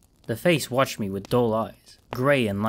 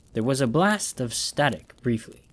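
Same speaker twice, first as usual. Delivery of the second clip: ordinary delivery, nothing marked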